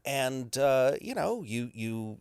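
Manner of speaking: normal talking voice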